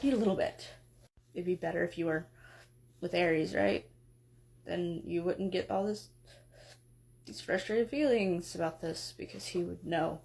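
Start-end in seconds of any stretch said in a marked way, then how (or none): 1.3-10.2 low, mumbly, just-woken god; drowsy, mumbling smith god; lightly voiced groggy Hephaestus. Hephaestus figure, wounded.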